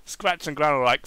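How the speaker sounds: ordinary delivery, nothing marked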